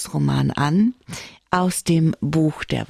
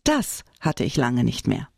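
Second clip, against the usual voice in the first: understated, lower dry remark; dry character line in a book reading; 6 semitones lower than usual. A narrator's reading. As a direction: deliver it drily, pitched down. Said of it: "sagte sie trocken".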